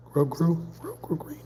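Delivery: very high, thin squeak dropping soft; squeaky echo. Pretend. Echoing the made-up color name, squeaky and high. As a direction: squeak the silly word back. Repeating a made-up word playfully.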